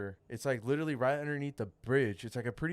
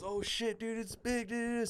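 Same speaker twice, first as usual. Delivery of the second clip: imitated amazed friend; 9 semitones higher, 4 dB quieter, slower than usual. Angler quoting his homie seeing the shark, mock amazement. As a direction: mimic a buddy marvelling, voice pitched up.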